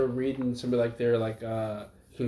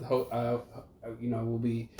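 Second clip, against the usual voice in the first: ordinary delivery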